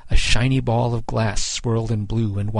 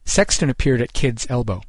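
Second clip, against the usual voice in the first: plain reading voice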